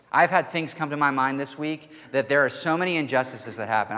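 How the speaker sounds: ordinary delivery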